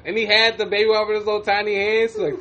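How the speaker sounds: normal talking voice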